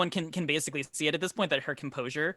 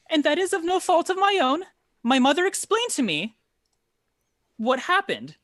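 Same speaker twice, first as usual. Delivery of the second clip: nervous noblewoman explaining; 7 semitones higher, 3 dB louder than usual. Acted. NPC speaking long in-character.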